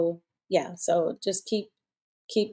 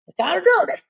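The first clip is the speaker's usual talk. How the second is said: teasing high aside; 12 semitones higher, 4 dB louder, faster than usual. Real, not acted. Teasing remark, high far voice.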